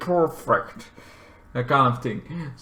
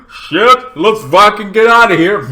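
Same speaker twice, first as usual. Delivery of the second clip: loud, raised-pitch outburst; alarmed urging; 6 semitones higher, 8 dB louder than usual. Acted. Siblings after old lady dies, alarmed yell. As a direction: blurt it out, urgent to flee.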